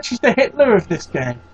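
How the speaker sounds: normal talking voice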